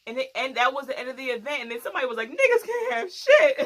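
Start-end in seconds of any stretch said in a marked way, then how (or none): 2.3-3.6 high, loud, drawn-out lament with laughter; hammed-up slow cry of exasperation; mock exasperated wail. Somebody at the end of the event, mock exasperated wail.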